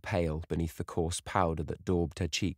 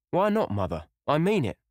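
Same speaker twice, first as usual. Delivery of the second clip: higher, louder, earnest insistence; insistent son; 10 semitones higher, 5 dB louder than usual. A narrator's reading. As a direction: push back on your mother firmly.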